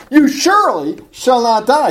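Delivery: SURELY pitched high and loud, a firm declaration; emphatic. Retold or imitated. Serpent contradicting God to the woman, emphatic. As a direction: state it with intensity, leaning hard on SURELY. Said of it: "He has no problem being emphatic".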